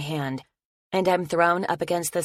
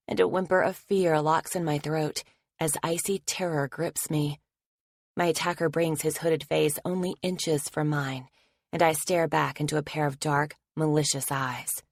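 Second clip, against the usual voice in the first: ordinary delivery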